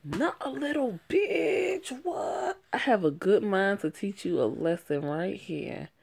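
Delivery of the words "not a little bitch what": high, slow mocking voice; sassy high mock correction; mock high retort. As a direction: throw the insult back, sneering high. Mocking the insult back.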